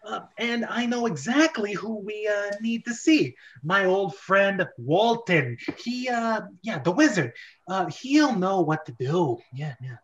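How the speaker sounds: high, eager sidekick voice; eager Toad. Acted. Toad telling the brothers about his wizard friend, high and eager. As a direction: pitch it up like an excited little helper.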